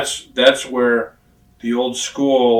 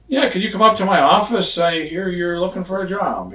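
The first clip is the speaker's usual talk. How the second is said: higher, upbeat imitation of the boss; boss on the phone; 7 semitones higher than usual. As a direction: lightly play the big boss calling. Vince on phone, retold.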